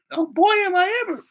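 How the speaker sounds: loud, high falsetto enthusiasm; eager girl. Retold or imitated. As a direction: squeal it as a famished girl would. Hungry girl accepts food.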